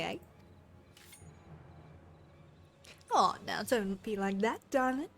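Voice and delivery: put-on lightly done rough British pirate accent; a sneering pirate voice in a shaky British accent; amateur British accent reading a pirate villain; attempted British accent. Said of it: "I apologize in advance for the accent".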